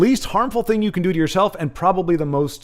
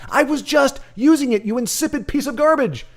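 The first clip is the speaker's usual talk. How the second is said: exasperated retort; 10 semitones higher than usual. Retold or imitated. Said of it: "And I'm like".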